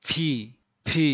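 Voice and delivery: ফি said between প and ফ, with a burst of air; ফি, lips together then a strong puff; model P sound. Shown as a demonstration. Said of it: "যেমন". (প আর ফ এর মাঝামাঝি ফি)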